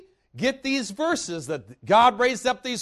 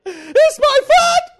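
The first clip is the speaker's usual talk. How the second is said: much higher, slow, laughing and near tears; laughing cry; 11 dB louder, much slower than usual. Real, not acted. Preacher confessing his own fault, laughing tearfully. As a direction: break into laugh-cry on the words.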